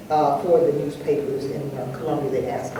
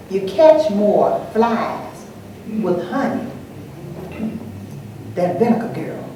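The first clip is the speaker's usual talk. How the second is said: slow, with long pauses between phrases; retold sage advice, drawn right out; measured coaxing advice; 2 semitones higher, slower than usual. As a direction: dole out the proverb bit by bit.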